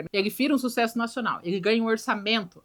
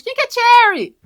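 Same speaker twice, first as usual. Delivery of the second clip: high, loud mock woman demanding; woman's indignant question; 12 semitones higher, 10 dB louder than usual. The woman inside demanding to know who Cherry is, high and loud. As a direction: squeal it like a jealous woman.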